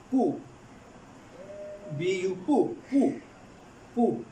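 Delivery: ordinary talk, nothing marked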